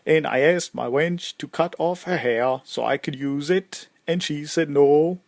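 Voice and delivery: put-on loud, boastful Swedish-accented storyteller. Famed warrior telling newcomers how he died, Swedish accent. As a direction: tell your own legend with a lilting Swedish accent. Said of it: "sorry, that was my Swedish, uh, accent".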